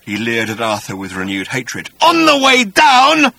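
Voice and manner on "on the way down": slow, high, loud snarl. Acted. Agrajag recounting his fall to Arthur, loud high snarl. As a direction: snarl it out, drawing the words long. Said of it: "he snarled".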